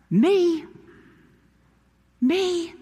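high, startled questioning; astonished to be the one chosen; incredulous. Acted. Moses doubts God's choice of him.

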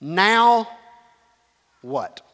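spaced-out "Now ... what?"; deliberate question. Shown as a demonstration. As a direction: let "what" drop away after "Now". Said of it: "we're gonna say it this way".

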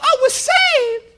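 very high, slow Southern belle; swooning mock-Southern lady, vowels stretched out; southern accent. Lady seeing the pursuers gone, relieved Southern falsetto. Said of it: "That's my southern accent".

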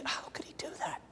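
breathy awed whisper; sudden whisper. Acted. Preacher whispering to the congregation in awe. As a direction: drop to a whisper in wonder. Preacher marvels at friend's courage.

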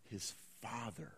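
whispered climax. Acted. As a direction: breathe it out as a reveal. Climax of abandonment list.

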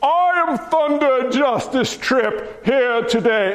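slow, hammed-up British accent; mock-heroic British declaration. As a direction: play a grand British hero, stretching the words. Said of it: "sounds awesome with a British accent".

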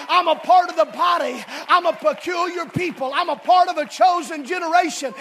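loud, high confession of faith; proud declaration. Acted. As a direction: declare it boldly as the youth would. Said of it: "stand up and say".

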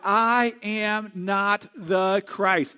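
slow, weighty stress on each word of "I am not the Christ"; firmly disclaim it, stretching out "I am not the Christ"; emphatic declaration. Acted. John tells followers he is not Messiah, emphatic. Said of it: "he wants to declare".

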